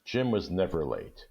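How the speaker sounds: usual speaking voice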